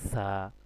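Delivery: "সা" with a long airy hiss before the vowel; air let out. Shown as a demonstration. Teacher showing an Arabic letter, "সা" with air escaping. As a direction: let air escape through the first sound of "সা". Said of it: "বাতাস বের হবে".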